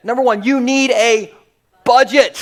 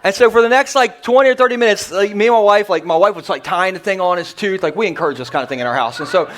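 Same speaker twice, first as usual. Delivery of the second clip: ordinary talk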